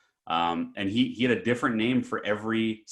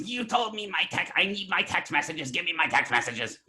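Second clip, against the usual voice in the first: imitating freaked-out customer; 8 semitones higher than usual. Old customer demanding his texts from the store, mock freak-out. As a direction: whine and demand like the frantic old man. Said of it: "The guy's freaking out".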